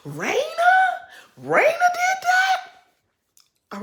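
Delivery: high, slow, laughing fake shock; mock shocked gasp. Mocking Monique's fake shock about Raina, high and laughing. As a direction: ham up a shocked gossip squeal. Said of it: "because she keeps saying Raina".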